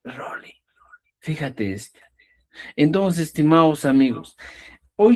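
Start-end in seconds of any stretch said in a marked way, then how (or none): 0.0-0.6 rolling whispered softly without voice; whispered word. Shown as a demonstration. 1.2-2.0 whisper. Real, not acted. Teacher aside to class.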